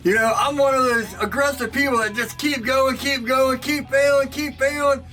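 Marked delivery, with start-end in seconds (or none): none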